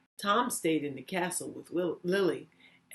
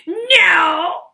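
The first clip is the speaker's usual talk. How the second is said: much higher, much louder, slow shouted goblin; 12 semitones higher, 15 dB louder than usual. Acted. Goblin taunts Lily with a deal, high slow shout. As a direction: bellow it like a gleeful goblin. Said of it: "smiled the goblin".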